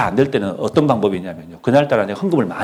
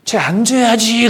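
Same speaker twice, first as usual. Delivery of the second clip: high, slow mock old-timer's pious vow; drawn-out, lifted voice of a self-assured elder; imitating the elders; 10 semitones higher, 3 dB louder, slower than usual. Preacher mimics church elders' vow, slow and high. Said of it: "이 말 하면 뭐라 하는지 아세요".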